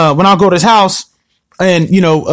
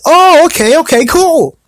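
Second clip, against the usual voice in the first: realization; 8 semitones higher, slower than usual. Retold or imitated.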